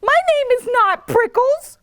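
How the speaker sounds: high, lightly acted angry yell; yelling back. A narrator's reading. Hank yells back at the jackrabbit, mock angry. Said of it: "Hank yelled back".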